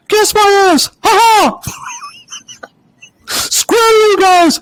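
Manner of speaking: very high cartoon mouse squeak with cackles; squeaky cartoon mouse taunting, slow on the insult; Mickey Mouse. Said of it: "you can hear him go".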